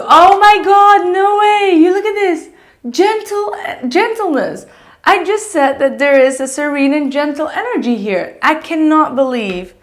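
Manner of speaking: high, loud, amazed squeal of disbelief; can't believe what the card shows, burst out high; excited disbelief. Real, not acted. Reader sees a matching card.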